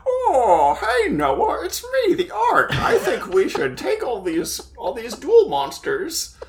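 high, laughing puppet voice; a goofy hand puppet talking; talking boat puppet. Pretend. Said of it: "like a hand puppet".

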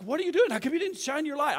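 high, loud panicked demand; freaking out. Retold or imitated. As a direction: imitate a panicked outburst at a friend. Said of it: "I'm freaking out".

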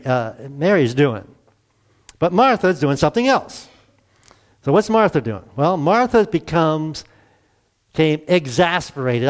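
ordinary delivery

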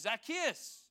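calling out. Acted. Jesus calls out to Zacchaeus, calling out. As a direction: call the name up high across a distance. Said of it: "he calls out to Zacchaeus".

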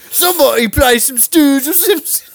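high, whiny British caricature; mock British accent. Bandmate begging for [name], high mock British wail. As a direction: wail it as a cartoon Brit. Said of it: "There he is doing British again".

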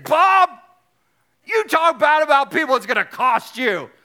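very loud, high, hollered warning; Bob Goff impression. Retelling the Lord's warning to him, hollered exuberant impression. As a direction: holler the name, then the warning. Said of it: "And this is how he talks".

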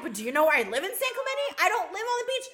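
high, fast, loud excuse; whiny defense. Retold or imitated. Employee explaining where he lives, high and fast. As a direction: rush to defend your story. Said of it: "He's like".

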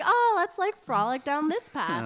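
high, loud playful singsong; mock carefree. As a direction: ham it up like skipping happily along. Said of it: "we were like".

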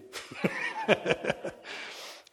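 a breathy high chuckle; laughing at the friend's wife's remark. Real, not acted. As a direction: laugh it off lightly.

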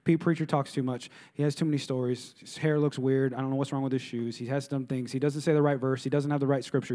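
low, quiet stream of nitpicking; imitated petty griping under the breath; mock complaining. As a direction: grumble through a list like a fussy churchgoer. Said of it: "got something to say about everything".

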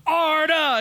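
high, loud chanted call; crowd shout. Retold or imitated. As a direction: call out half the name like a crowd. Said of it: "on one side they go".

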